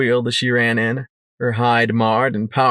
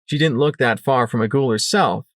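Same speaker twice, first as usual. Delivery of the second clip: ordinary delivery, nothing marked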